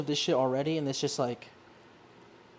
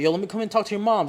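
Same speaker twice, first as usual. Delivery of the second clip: eager friend; 7 semitones higher, 5 dB louder, faster than usual. Retold or imitated. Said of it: "he's like".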